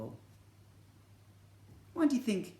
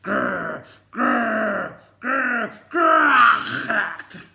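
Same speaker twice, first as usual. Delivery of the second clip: growls growing louder and higher, ending in a shout; tiger growling; 8 semitones higher, 12 dB louder, much slower than usual. Acted. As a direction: build a fierce tiger growl to a roar. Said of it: "making terrible growling noises".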